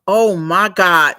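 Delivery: ordinary delivery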